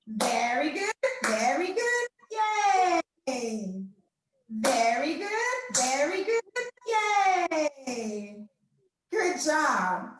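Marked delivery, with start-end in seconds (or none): 0.1-8.5 rising cheer. Shown as a demonstration. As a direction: cheer upward to the yay. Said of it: "Let's do it together again".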